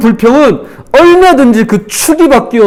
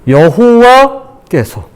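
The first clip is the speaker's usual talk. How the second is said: 여호와 stretched out very slowly; slow, weighty announcement of 여호와; emphatic YEOHOWA; much slower than usual. Shown as a demonstration. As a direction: draw out 여호와 with weight, then drop on 께서. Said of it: "이렇게 바뀌어요".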